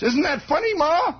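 put-on high, guileless boy's voice; innocent kid. Boy naively asking his mother if it's funny. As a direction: ask it sweetly like a naive boy.

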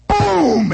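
high, loud imitated crash; loud mock crash; loud boom. Car hits the shed.

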